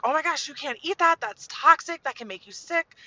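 high, loud, rapid panicked warning; freaking out. Retold or imitated. Mom warns her daughter off eating rock salt, high-pitched freak-out. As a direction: act out a mother overreacting in alarm. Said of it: "I'm, like, freaking out".

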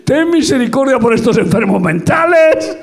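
high, loud, laughing plea; half-joking cry for mercy from the asylum; mock shout. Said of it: "en el manicomio dices".

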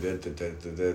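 robotic talk. Shown as a demonstration. Host mocking how he sounded on his last podcast, robotic. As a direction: drone lightly like a robot. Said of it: "I feel like I'm a robot".

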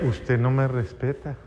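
low, very soft retort; réplica del hijo. Acted. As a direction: answer the parent back quietly, pitch dropped. Hijo replica al papá. (réplica bajita y grave del hijo)

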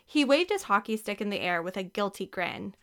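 ordinary delivery, nothing marked

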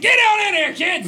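put-on high, loud shout to the kids; loud yell. Shown as a demonstration. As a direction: bellow it through the door. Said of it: "you have to yell real loud".

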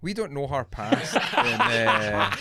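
breaking into laughter mid-line; the mock seriousness cracks into a laugh. Real, not acted. Host laughs at own intervention voice, laughing. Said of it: "this is my intervention voice".

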